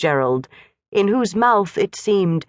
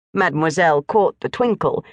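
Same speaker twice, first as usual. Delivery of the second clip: ordinary delivery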